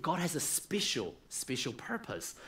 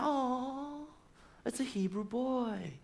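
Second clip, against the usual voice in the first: surprised princess; 7 semitones higher, 6 dB quieter, slower than usual. Acted. Pharaoh's daughter finds baby.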